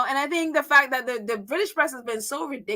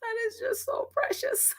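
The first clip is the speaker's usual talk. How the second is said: soft, high, breathy coo with a laugh; gushing aww; 11 semitones higher, 8 dB quieter than usual. Retold or imitated. Reacting to the boy's sweet gesture, soft high laughing coo. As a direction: melt over something adorable, softly and high. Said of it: "And I just thought that was like".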